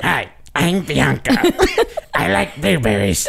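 slow goofy voice with laughter; brother's silly portrait voice; yearbook voice. Pretend. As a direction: play a yearbook girl, slowly. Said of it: "my brother, like".

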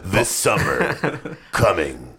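very low, slow movie trailer announcer; deep, portentous trailer narration with dramatic pauses; RoboCop trailer voice. Acted. Host narrates his own mock action trailer, deep slow announcer voice. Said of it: "like, a RoboCop".

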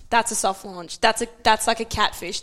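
ordinary talk, nothing marked

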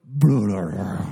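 deep gurgling stomach noise; stomach gurgle. Retold or imitated. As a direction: voice the stomach's low groan. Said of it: "my stomach goes".

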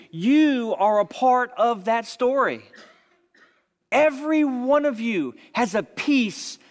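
fervent sermon appeal; preaching. Acted. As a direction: lift the congregation, building fervour. Preacher applies story to congregation.

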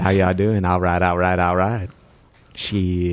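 McConaughey Texan drawl. Pretend. Podcaster mimicking McConaughey, lazy Texan drawl. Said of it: "embrace the McConaughey accent".

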